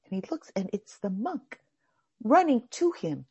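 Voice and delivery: normal talking voice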